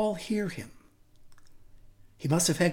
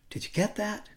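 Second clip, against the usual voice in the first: normal talking voice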